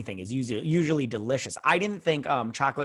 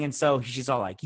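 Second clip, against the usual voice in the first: usual speaking voice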